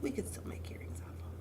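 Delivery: soft whisper to herself; whispered aside. Real, not acted. Crafter whispering an idea over her beads, softly. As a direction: whisper the idea as if thinking aloud. Musing aloud while sorting beads.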